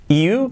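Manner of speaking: careful English "you", spelt "Iu"; correct "you" glide. Shown as a demonstration. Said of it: "Que no sea chiu".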